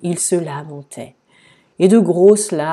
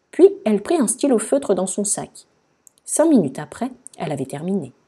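ordinary delivery

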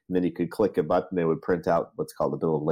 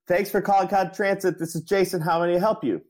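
much higher, louder strained greeting; a mock frantic receptionist straining up high; getting louder; 13 semitones higher than usual. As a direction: shout the script to be heard. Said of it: "getting louder and louder".

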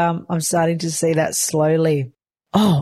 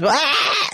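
a short mock baby wail, much higher; imitated baby cry; 8 semitones higher than usual. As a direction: copy the baby's grumpy little yell.

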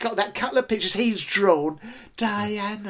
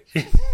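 high burst of laughter; 13 semitones higher, 7 dB louder than usual. Real, not acted. Laughing at his own story.

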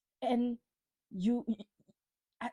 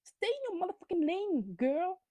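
sassy telling-off; 9 semitones higher, slower than usual. Pretend. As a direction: tell her off, slow on stay in your lane. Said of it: "and I'm like".

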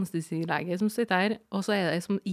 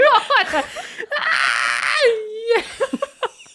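a high, loud laughing howl; howling laugh-cry; 8 dB louder than usual. Real, not acted. Recalling the midwife's answer, high loud laugh-cry. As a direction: laugh and cry at once, much higher and louder. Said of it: "aldri jeg har vræla så mye".

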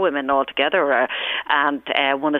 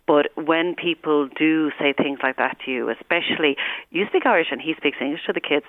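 usual speaking voice

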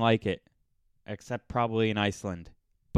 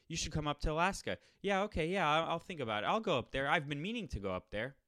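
higher, soft mock-polite promises; insincere small talk; 5 semitones higher, 5 dB quieter than usual. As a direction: rattle off empty agreement as if scripted. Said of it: "you say what you're supposed to say".

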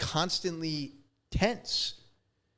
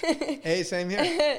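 much higher delighted exclaim; excited surprise; 12 semitones higher, 5 dB louder, much slower than usual. Real, not acted. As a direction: pop up high and bright with surprise. Shared birth year surprise.

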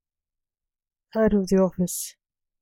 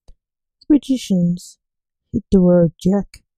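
dramatic title announcement; 6 dB louder than usual. A narrator's reading. Dramatic episode title read.

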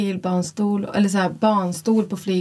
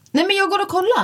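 higher, insistent I'm going; determined; 11 semitones higher than usual. Retold or imitated. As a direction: overrule him and go check. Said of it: "Jag bara". (bestämt och ljusare: jag går och kollar)

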